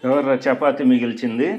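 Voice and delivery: calling out. Real, not acted. Parent asks who left the chapati, loudly calling out. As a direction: call it out to the household. Parent calls out about leftover food.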